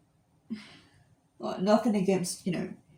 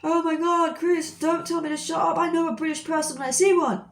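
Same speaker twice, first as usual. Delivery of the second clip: high-pitched indignant protest, retold; mock pissed off; 7 semitones higher than usual. Arguing with Chris who said shut up, mock pissed off. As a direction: argue back in a high, worked-up voice. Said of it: "really getting pissed off".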